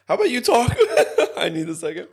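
high, breathless laughing plea; laughing in pain. Real, not acted. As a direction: laugh through the burn. Overwhelmed by spicy chip.

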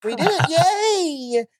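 very high, slow mock cheer; sarcastic whiny girl. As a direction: drag out a fake yay. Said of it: "she's yelling".

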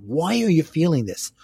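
ordinary delivery

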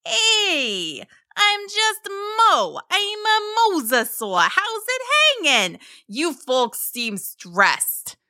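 high, friendly mosasaur voice with drawn-out words; high-pitched cartoon creature, casual and chummy. A narrator's reading. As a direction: introduce yourself as an easygoing, chatty sea monster. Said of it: "announced in friendly tones".

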